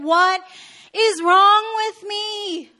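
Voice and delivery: loud, high, drawn-out mock despair; exasperated self-reproach. Pastor scolding herself over being childish, exasperated. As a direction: wail at yourself, hammed-up.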